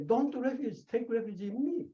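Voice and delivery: a hint of a solemn Buddha speaking; quoting the Buddha. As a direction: lift the voice to give the Buddha's command. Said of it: "Shakyamuni say".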